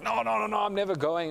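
high, insistent mock protest; acted stubborn refusal. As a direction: imitate a brother digging his heels in. Brother flatly refuses invitation.

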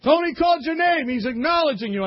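high, loud, urgent nudging; excited friends. Retold or imitated. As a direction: tell your buddy he's been called. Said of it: "I said".

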